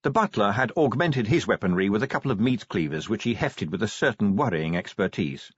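ordinary delivery

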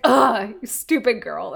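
put-on scornful old teacher sneering; Bagra voice. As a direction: sneer it out with contempt. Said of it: "That was a good Bagra impression".

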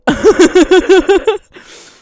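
high, loud burst of laughter; real cackle, long and high. Real, not acted. Laughing at her own denial of mood swings, loud laugh.

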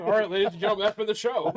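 high, loud showman's closer; mock emcee sign-off. Co-host closing after a bad pun, mock emcee. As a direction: project it like a comic ending the act.

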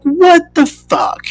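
high, slow, drawn-out disbelief; stunned disbelief. Retold or imitated. Told he's moving back, stunned disbelief. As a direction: stretch it out, stunned. Hearing he moves away.

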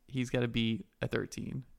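ordinary talk, nothing marked

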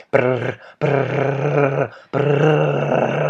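soft rattling brrr noise; imitated engine rattle. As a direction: mimic a shaking car. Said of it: "It was like".

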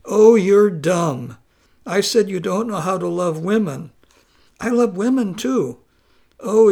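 loud, exasperated wife berating him; wife venting at her baffled husband; exasperated scolding. Acted.

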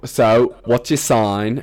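loud mock British reality-show contestant; British accent. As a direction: ask it loudly in a put-on British accent. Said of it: "that was an amazing, um, accent".

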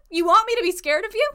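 high, loud disbelief; baffled, pushing back; incredulous. Real, not acted. Incredulous reaction to DM.

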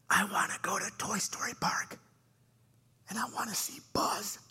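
put-on airy whisper, like a little boy; imitated child whisper. Son answering Dad about Toy Story Park, whispering. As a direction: whisper back as the small son. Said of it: "I'm whispering back".

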